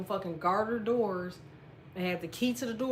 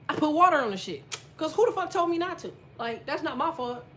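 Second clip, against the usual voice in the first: louder, higher, defensive; indignant outburst; 6 semitones higher, 3 dB louder than usual. Real, not acted. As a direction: protest that nobody warned you. Indignant telling of mistake.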